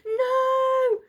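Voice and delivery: very high, very loud cry of No; boy screams as the shot hits. A narrator's reading. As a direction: scream in despair. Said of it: "William screamed".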